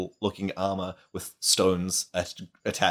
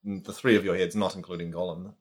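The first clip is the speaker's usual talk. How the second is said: ordinary talk, nothing marked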